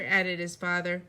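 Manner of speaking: ordinary delivery, nothing marked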